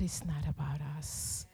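hushed whisper, "that it's not about us" breathed unvoiced. Pastor to congregation, whispering "not about us".

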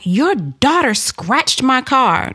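high, slow mock outrage; retold screaming accusation. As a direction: imitate an irate stranger accusing, drawing it out. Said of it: "she's screaming".

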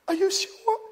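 high, breathless disbelief; waitress asking if the tip is real, high; waitress voiced high. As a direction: pitch it way up like a stunned young waitress. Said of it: "She said".

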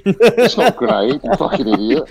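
mock exasperation. Suspect scolding the soldiers, high mock scorn. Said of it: "looking at us going".

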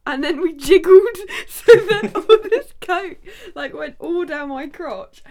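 high, loud, laughing through the words; laughing while telling. Real, not acted. Telling how Coke soaked the lap, laughing hard. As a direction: crack up as you tell it.

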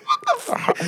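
very high squeaky disbelief; high-pitched retold disgust; shocked disbelief. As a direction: replay the moment of pure shock.

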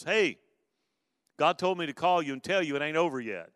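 urgent friend's call. Retold or imitated. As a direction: announce it like urgent good news.

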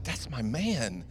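low, slow, lightly voiced admiring wife; husband imitates his wife's mock admiration for his jersey. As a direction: purr it like a half-joking compliment. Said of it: "she's like".

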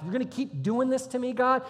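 high-pitched, acted exasperation; exasperated. Angry Abraham complains to God, exasperated. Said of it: "laughing 'cause he's angry".

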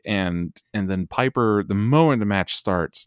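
normal talking voice

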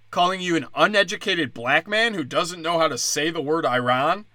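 normal talking voice

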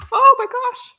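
sudden high, loud yelp; startled mid-sentence outburst; real startled alarm. Real, not acted. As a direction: blurt it out in alarm, pitch leaping up. Nearly drops ink on painting.

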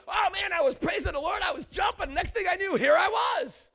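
high-pitched, jubilant praise story; excited testimony. Acted. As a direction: act out an exuberant believer telling it. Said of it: "you should say something like".

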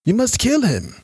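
high, urgent plea from a sick man; urgent dying man. Acted. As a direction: press him hard to kill.

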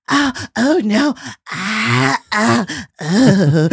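hammed-up breathy moans, a bit high; mock moaning. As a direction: play at getting into it, lightly. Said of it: "where she's like".

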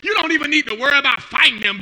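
ordinary talk

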